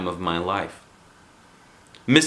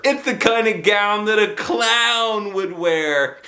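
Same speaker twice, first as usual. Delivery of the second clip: higher, slow mocking sing-song; teasing girl; 11 semitones higher, 4 dB louder, slower than usual. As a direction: make fun of the gown. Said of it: "said Polly Allen Puffer".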